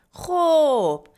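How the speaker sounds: long, loud, high-pitched "خب"; drawn-out okay. A narrator's reading. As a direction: stretch it out to say you got it. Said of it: "خوابش را سه ثانیه کشید". («خب» کشیده و بلند)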